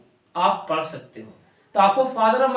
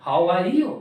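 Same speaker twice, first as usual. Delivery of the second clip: "How are you?" in a low-pitched accent, rising at the end; accent demo, second variant; 6 semitones lower, much slower than usual. Shown as a demonstration. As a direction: demonstrate another accent, dropped low. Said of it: "और मैं बोलूँ".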